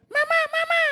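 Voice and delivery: put-on tiny, very high squeaky child calling mama; high-pitched childish call; young child's voice. Shown as a demonstration. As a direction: squeal it like a little kid. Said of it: "pati yung boses. Nag-iiba".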